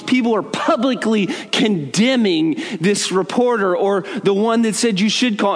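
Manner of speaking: ordinary talk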